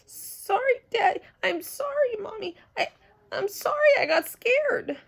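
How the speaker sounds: high, tearful apology; teary. Acted. Junie B tearfully apologizing to Mom and Dad. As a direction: near tears, pleading. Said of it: "my eyes got tears in them".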